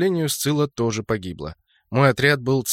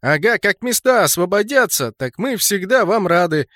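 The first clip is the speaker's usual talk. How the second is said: raised, high-pitched jeer; chiming in mockingly; 6 semitones higher, 3 dB louder than usual. A narrator's reading. Second enemy backing up his mate's jeer, chiming in mockingly. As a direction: join the taunt, calling across. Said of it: "поддакнул второй".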